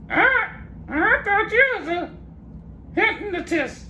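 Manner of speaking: very high squawky parrot speaking. Acted. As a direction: talk back as a cheeky parrot, very high. Parrot dummy talks back.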